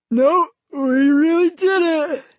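put-on high slow sleepy boy; yawning. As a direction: say it mid-yawn. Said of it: "still yawning from sleep".

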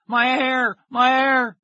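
high, loud, long-held wailing; anguished wail. A narrator's reading. Boy with a phone glued to his ear, wailing. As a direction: wail it out like a disaster. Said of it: "he wailed".

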